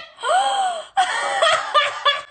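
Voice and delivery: huge gasp into shrieking laughter; loud, high, shrieking gasp; excited gasp. Real, not acted. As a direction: gasp and squeal with delight. Delighted at solving it.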